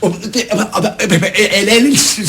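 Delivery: stunned, broken false starts; flustered stammer. Acted. Konstantinos reels from Eleni's insults, flustered stammer. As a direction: stumble over words, unable to begin.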